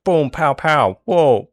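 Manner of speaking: high, loud cartoon explosions; punchy vocal sound effects for a battle; mock gunfire noises. Storyteller acting out guards fighting back, excited boom-pow noises.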